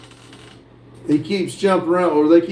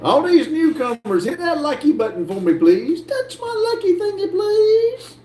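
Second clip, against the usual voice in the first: pleading whine; 11 semitones higher than usual. Pretend. Begging viewers for the button.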